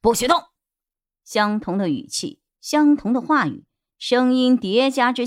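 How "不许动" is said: loud answering shout; shouting back the same. Acted. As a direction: yell it right back at him. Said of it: "屋中的人也同样喊出了这句话".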